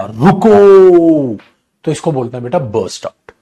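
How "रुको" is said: loud, long-drawn call to stop; sudden shout. Shown as a demonstration. Teacher repeats the example of bursting out. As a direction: yell रुको all at once. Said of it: "एकदम से उसने चिल्लाया".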